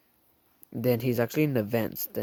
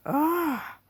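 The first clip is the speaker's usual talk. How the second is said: scream. Acted. Rips off the wires.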